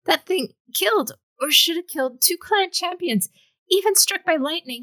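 put-on a frightened young man whose dam has broken; losing control. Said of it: "He knew that he was losing control".

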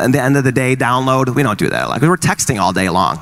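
ordinary delivery

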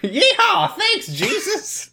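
mock hillbilly prayer; cowboy whoop. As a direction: whoop then thank Jesus like a yokel. Playing the country boy.

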